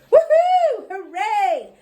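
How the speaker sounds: high whooping cheer; cheering child. A narrator's reading. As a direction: whoop with joy. Junie dances around.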